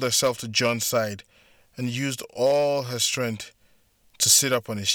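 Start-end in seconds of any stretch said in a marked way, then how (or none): none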